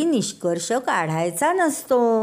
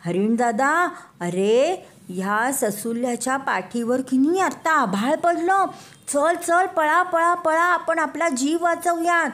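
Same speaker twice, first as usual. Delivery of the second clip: high, rising frantic chick voice; panicky chick; 8 semitones higher than usual. Acted. Chick warning the deer about the sky, rising panic. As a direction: builds into a scared squeal. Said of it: "कोंबडीचं पिल्लू म्हणालं".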